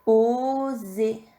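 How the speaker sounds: "Oser" with a long, held O; syllable dictation. Shown as a demonstration. Teacher dictating the second syllable, slow and drawn out. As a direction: stretch "Oser" slowly for dictation. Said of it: "Deuxième syllabe".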